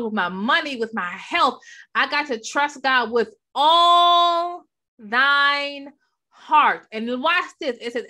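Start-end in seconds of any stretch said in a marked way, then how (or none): 1.9-6.9 "all thine heart" slow and loud; stress on all. Shown as a demonstration. Preacher pressing the congregation to trust fully, slow and loud. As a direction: drag out "all thine" then hit "heart" louder. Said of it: "Emphasis on the word all".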